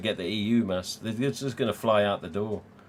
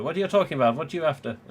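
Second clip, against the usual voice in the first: higher-pitched retold banter, tipping into laughter; amused mock questioning; 6 semitones higher, much faster than usual. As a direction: imitate phone banter, ending in a laugh. Retelling phone banter, laughing.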